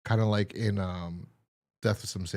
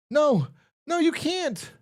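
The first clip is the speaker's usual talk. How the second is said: put-on high, loud old-timer squeal; old man pleading; 4 dB louder, slower than usual. The old man pleading with the killers in a high squeal. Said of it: "old man pleads".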